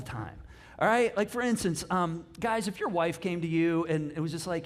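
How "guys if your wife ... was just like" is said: ordinary talk, nothing marked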